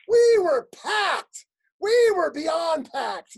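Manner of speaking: PACKED drawn out slowly, high and loud; emphatic mock boast. Comic boasts of a spaced-out crowd, emphatic on "packed". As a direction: stretch "packed" like a huge brag.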